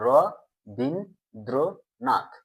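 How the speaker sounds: রবীন্দ্রনাথ very slowly, split as র, বীন, দ্র, নাথ; syllable by syllable. Shown as a demonstration. Teacher shows students the syllables, sounding out রবীন্দ্রনাথ very slowly. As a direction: break রবীন্দ্রনাথ into four drawn-out syllables with gaps. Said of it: "কি উচ্চারণ করছি?".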